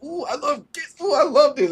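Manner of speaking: high, giddy imitation of a gift lover; giddy delight. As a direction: squeal like a kid over presents. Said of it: "we kind of just like".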